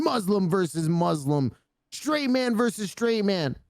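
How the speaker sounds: hammed-up mastermind exultation; mock villain gloating. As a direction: build to a high-pitched, triumphant declaration.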